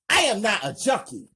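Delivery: high, loud and fast protest; mother hurt and angry at her son's insult; angry denial. Acted. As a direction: fire it back at him. Mother denies son's accusation.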